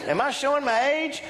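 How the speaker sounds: usual speaking voice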